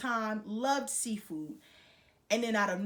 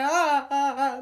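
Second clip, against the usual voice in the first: loud mock panicked "ah, ah, ah"; hammed-up panicky yelps; freaked-out cries; 3 semitones higher, 7 dB louder, slower than usual. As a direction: act out repeated cries of alarm. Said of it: "so now I'm freaking out".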